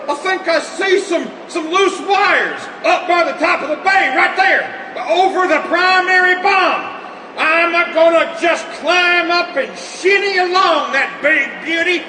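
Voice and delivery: excited shout. Acted. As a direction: holler it up over the noise. Shouting from the bomb bay.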